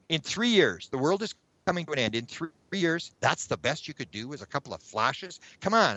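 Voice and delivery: retold incredulous complaint, pitch jumping up; acted-out exasperation at a feeble effort; exasperated disbelief. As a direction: challenge them as badly let down. Thought complaint at underwhelming alien flashes.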